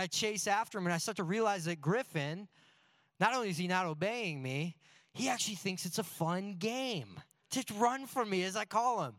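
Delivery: normal talking voice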